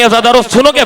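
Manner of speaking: normal talking voice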